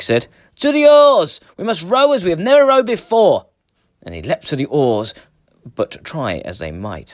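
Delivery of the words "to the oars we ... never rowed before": high, loud battle command; rallying shout. A narrator's reading. Eric shouting to his crew to man the oars, loud rallying command. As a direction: rouse the crew to row for their lives.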